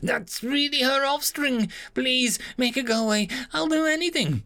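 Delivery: high, panicked alien voice; a frightened creature begging it to stop; terrified alien. Acted. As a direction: squeal in terror at the door. Alien pleading.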